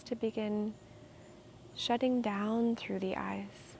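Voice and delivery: calm, unhurried meditation guide with long pauses; soothing, measured meditation voice; guided meditation. Real, not acted. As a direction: guide them gently into stillness, leaving space between phrases.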